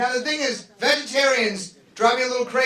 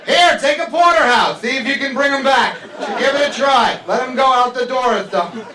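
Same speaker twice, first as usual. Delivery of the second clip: loud, high mock panic at the supermarket; hammed-up shouting over a dead cow; 4 semitones higher, 7 dB louder than usual. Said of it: "I'm in there like".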